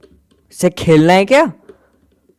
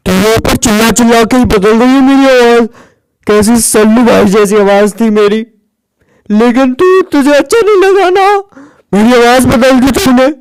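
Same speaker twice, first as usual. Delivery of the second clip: high, loud put-on voice; hoarse from shouting; 6 semitones higher, 3 dB louder than usual. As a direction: rant in a changed funny voice. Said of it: "तुम्हारी आवाज को क्या हुआ यार".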